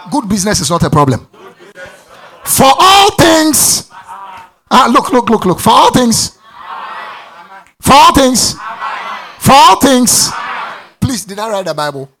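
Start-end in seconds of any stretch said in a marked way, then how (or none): 2.4-10.3 louder each time, leaning on all; preaching repetition. Acted. Pastor drilling a verse into the congregation, shouted. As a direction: hammer the word all home.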